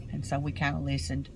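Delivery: ordinary delivery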